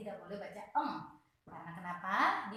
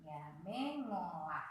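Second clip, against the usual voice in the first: slow demo read; 4 semitones lower, 5 dB quieter, slower than usual. Shown as a demonstration. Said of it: "harus ngo".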